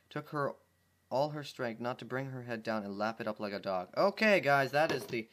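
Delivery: plain reading voice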